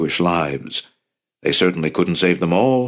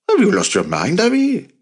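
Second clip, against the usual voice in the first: incredulous; 11 semitones higher than usual. A narrator's reading. Commander questions Avi's attack plan, incredulous. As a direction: voice leaping high on the name. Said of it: "The commanders were incredulous".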